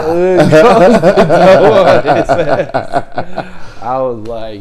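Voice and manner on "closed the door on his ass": loud, slow, high, through laughter; helpless laughing. Real, not acted. Storyteller repeating the punchline, helplessly laughing. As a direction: barely get it out for laughing.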